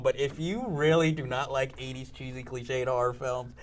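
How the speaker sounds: ordinary talk, nothing marked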